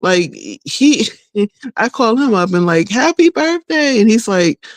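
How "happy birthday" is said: high, bright "Happy birthday"; cheerful birthday greeting. Retold or imitated. Calling son on birthday.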